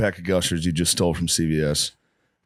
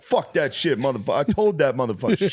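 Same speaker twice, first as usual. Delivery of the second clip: higher, ranting to nobody; imitating a street ranter; 6 semitones higher than usual. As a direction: do the guy arguing with himself on the street. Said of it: "walk past you like".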